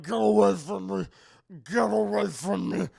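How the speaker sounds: loud, slurred creature wail; hammed-up mutant in agony; melting monster voice. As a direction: bellow it through a dissolving throat. Voicing the melting Charlie.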